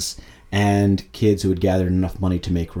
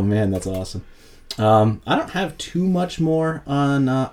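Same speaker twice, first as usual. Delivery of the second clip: ordinary talk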